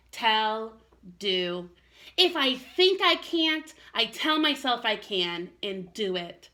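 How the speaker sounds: put-on gentle storybook narration for kids; reading to children. A narrator's reading. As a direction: read it like a bedtime story for little ones. Teacher reads a children's story aloud.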